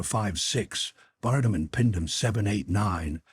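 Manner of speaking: plain reading voice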